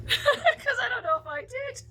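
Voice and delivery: high, slow words through laughter; laughing. Real, not acted. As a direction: crack up at your own joke. Joking, laughs at own remark.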